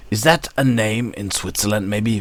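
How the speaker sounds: usual speaking voice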